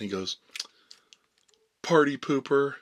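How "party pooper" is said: imitated buddy's jeer, higher and much louder; big pitched-up mock taunt; loud heckle. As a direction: blurt it into dead silence. Buddy's heckle into the silence, acted.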